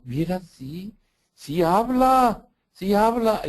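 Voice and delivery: put-on high, loud, slow child's joyful exclaim; a thrilled child announcing that Jesus talked to her; excited little girl. Priest voicing the girl's delight after she prays.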